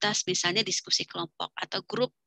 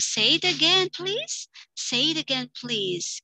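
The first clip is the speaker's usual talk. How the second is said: raised, coaxing request; asking to repeat; 6 semitones higher, slower than usual. Shown as a demonstration. Teacher asking a student to repeat, coaxing. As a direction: gently ask for one more try. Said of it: "Kita minta siswa untuk mengulang kembali".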